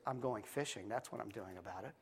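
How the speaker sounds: soft, smug little-sister voice; low-key gloating, hushed; quiet blackmail. Acted. Sister quietly blackmails her brother, smug and hushed.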